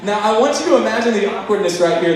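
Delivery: normal talking voice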